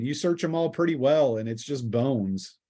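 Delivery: ordinary talk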